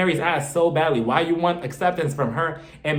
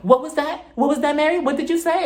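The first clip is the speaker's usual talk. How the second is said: higher, pushy mock Whitney; Whitney snapping at Mary across the table, higher and pushy; tipsy confrontation; 9 semitones higher than usual. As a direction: demand it like she's had three shots. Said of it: "she was like".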